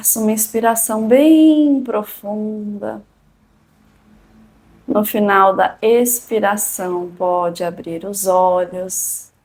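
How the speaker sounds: calm and unhurried, long pauses; soothing spa whisper. Acted. Leads a guided meditation.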